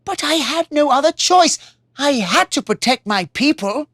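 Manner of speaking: a father blaming himself; troubled king. Acted. King regretting sending the warrior to fight, remorseful. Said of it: "he said".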